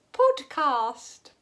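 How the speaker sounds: "Podcast" in a high, loud mock-posh accent. Shown as a demonstration. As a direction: try out a put-on posh "Podcast". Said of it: "how do you say podcast in a posh voice".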